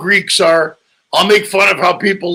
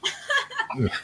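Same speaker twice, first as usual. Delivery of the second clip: real laugh; 15 dB quieter than usual. Real, not acted. Laughs at own joke.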